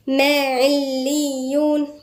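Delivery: slow, melodic tajweed chant; measured Quranic recitation. Acted. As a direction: recite the verse slowly, drawing out the vowels. Recites slowly.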